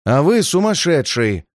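mild read-aloud anger; a touch of angry impatience; angry exclamation. A narrator's reading. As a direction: snap back with understated irritation. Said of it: "гневно закричал сыщик".

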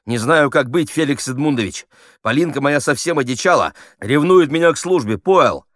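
high, loud, gabbling actor; breathless-eager amateur gabble; hurried, swallowing words. A narrator's reading. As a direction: rush on, tripping over the words. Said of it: "заговорил быстрее, потом ещё быстрее".

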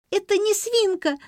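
put-on very high, loud child's squeal. A narrator's reading. Girl insists to adults it is no pig, squealing high. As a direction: squeal it like an upset little girl. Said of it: "завизжала Лерка".

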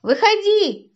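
громкий высокий окрик; крикни повыше, вызывая спрятавшегося. A narrator's reading. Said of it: "закричал Котька". (громко кричать)

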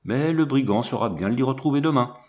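plain reading voice

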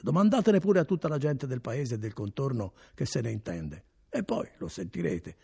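a hint of a boastful innkeeper; lightly voiced innkeeper. A narrator's reading. Innkeeper vouching for his wine to Renzo, lightly voiced. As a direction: sell the wine with easy pride.

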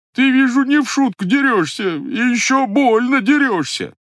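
disguised voice, aggrieved and timid; pained, timid disguise. Acted. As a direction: protest in pain but don't fight back. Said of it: "боль, и досада, и робость".

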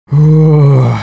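loud sigh of relief; relieved sigh. Acted. The unicorn relaxes after Kuna's confession, sighing. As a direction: let the breath out in relief. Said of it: "обмяк от облегчения".

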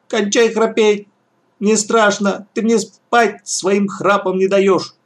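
put-on high, slow whiny little boy; plaintive child cry. A narrator's reading. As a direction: call out plaintively like a scared child. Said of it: "Лёшка жалобно мне крикнул". (жалобный детский крик)

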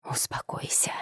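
soft unvoiced whisper. A narrator's reading. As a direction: breathe it out gently. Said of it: "продолжал нашёптывать Влад".

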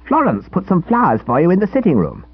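high, eager schoolboy voice; cheeky boy scout. A narrator's reading. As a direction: play the smug little scout, pleased with himself. Narrator voices Edwin.

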